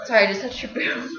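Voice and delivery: normal talking voice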